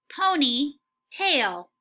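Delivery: slow, stretched out ponytail; slow model word. Shown as a demonstration. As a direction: say "Ponytail" slowly, drawn out syllable by syllable. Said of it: "Try and say it right before me".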